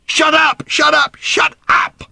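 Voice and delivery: high, loud, repeated outburst; furious shouting. A narrator's reading. Daughter silencing her father mid-row, shouting furiously. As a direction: scream each one at him.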